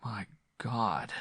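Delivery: groaning. A narrator's reading. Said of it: "he groaned".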